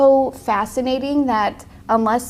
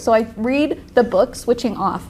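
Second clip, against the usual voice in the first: ordinary delivery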